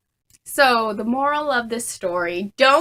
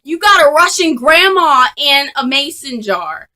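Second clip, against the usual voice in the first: mock loud jab; 4 semitones higher, 9 dB louder than usual. Mocking the SCOBY jar.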